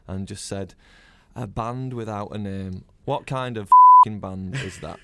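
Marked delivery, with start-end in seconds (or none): 1.3-5.0 disgusted rock star. Retold or imitated.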